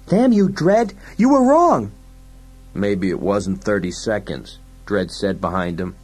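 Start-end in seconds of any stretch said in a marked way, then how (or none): 0.1-1.9 high, loud, furious cry; panicked yell. Acted. Fergie curses Dredd as the fireball comes, high yell. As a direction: scream the accusation in panic.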